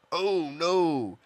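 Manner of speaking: loud, high wail of dismay; dismayed cry. Real, not acted. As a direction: cry out as the game goes wrong. Sudden setback in the game.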